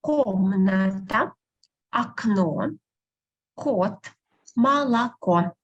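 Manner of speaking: "Комната, окно, кот, молоко" word by word, KOM-na-ta, ak-NO, KOT, ma-la-KO; careful pronunciation model. Shown as a demonstration. Teacher asking for attention, "Комната, окно, кот, молоко" carefully separated, unstressed O as A. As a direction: model "Комната, окно, кот, молоко" deliberately, full O only where stressed. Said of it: "Pay attention how I pronounce it".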